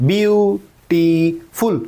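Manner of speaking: Beautiful stretched out slowly, part by part; syllable by syllable. Shown as a demonstration. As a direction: split beautiful into slow, separate syllables. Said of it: "তো অনেকবার পড়তে হচ্ছে". (Beautiful ধীরে, syllable ভেঙে ভেঙে)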